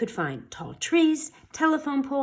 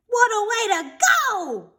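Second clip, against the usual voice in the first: much higher mock wail; 12 semitones higher, 3 dB louder than usual. A narrator's reading. The science teacher in the monster's paw, wailing high. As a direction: wail it out in theatrical despair. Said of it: "wailed the science teacher".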